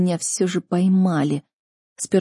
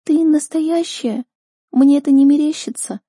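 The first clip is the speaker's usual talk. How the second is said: tearful disbelief; 8 semitones higher, 4 dB louder than usual. A narrator's reading. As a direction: ask in high-pitched, aching hope. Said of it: "И снова душераздирающий всхлип". (высоко, со слезами и недоверием)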